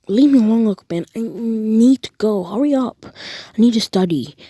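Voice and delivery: impatient whiny shout. Acted. Deku brushing off uncle.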